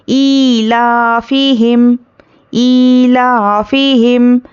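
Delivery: slow chanted tilawat; Quran recitation. Shown as a demonstration. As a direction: recite slowly with long vowels. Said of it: "teacher recites the word". (धीमी तिलावत)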